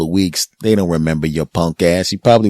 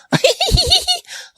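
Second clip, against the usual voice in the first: squeaky high-pitched giggle; high baby giggle. Retold or imitated. Baby amused by the old guy's face, high giggle.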